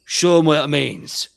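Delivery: slow, loud battle rally; loud, stretched war-leader's cry; rallying orc shout. Acted. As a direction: call out to the lads, drawn out. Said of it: "and he goes".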